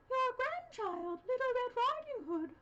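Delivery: high, slow, loud mock girl voice; wolf imitating girl. A narrator's reading. Wolf faking the girl's voice at the door. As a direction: fake a little girl's voice. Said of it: "imitating her voice".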